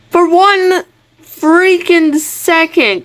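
high, slow, drawn-out exasperation; exasperated emphasis. Real, not acted. Player wanting a break from frog, exasperated. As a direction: stretch out each word, totally fed up.

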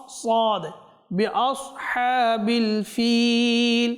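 slow, melodic tajweed chant; slow chanted Quranic recitation. Shown as a demonstration. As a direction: draw out each Arabic syllable in measured recitation. Teacher recites verses slowly.

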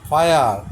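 ফায়ার said loud, বলা with আয় sound; model pronunciation. Shown as a demonstration. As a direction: model ফায়ার loudly to the class. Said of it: "এই যে আয়ের উচ্চারণ". (জোরে ফায়ার উচ্চারণ)

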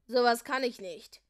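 loud, grumpy growl. A narrator's reading. Leo refusing his punishment, grumpy loud growl. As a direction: growl it out sullenly. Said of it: "knurrte Leo der Lügner".

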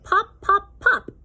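high popping sounds; cork pop. Pretend. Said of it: "He can sound like a cork".

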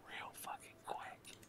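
breathy whisper, slow and hushed; whispered aside. Real, not acted. Telling friends he got heated, in a slow whisper. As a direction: drop to a hushed whisper for emphasis.

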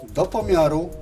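usual speaking voice

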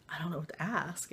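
a hushed whisper back to her friend. Retold or imitated. Answering her friend in a whisper during ritual. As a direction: whisper it so the ritual isn't disturbed.

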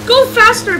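high, loud mimicry; mocking echo. Driver parroting the passenger's demand, mocking echo. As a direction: parrot it loudly to mock.

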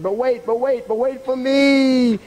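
high, rising rabbit cry; desperate calling. Acted. Rabbit calling after the departing dog, desperate. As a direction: build to a long, loud last word. Rabbit left behind calls out.

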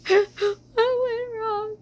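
high, slow hammed-up wail; fake-sob the question for laughs; mock crying. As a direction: melodramatic mock weeping, laughing through it. Mock despair about growing up.

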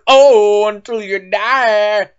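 loud put-on grandpa voice; grandpa figure. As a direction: holler the greeting as a hammed-up grandpa. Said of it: "I'm Diesel's Grandpa".

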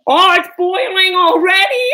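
loud, high alarmed cry; startled shout at the pot. Real, not acted. Noticing the pot is boiling, alarmed loud cry.

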